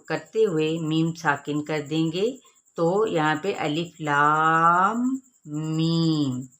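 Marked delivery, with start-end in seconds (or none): 3.6-6.5 slow, drawn-out अलिफ लाम मीम; slow tajweed chant; stretched letters. Shown as a demonstration. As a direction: hold each letter long and even. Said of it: "छह हरकात के बराबर खींचा जाएगा".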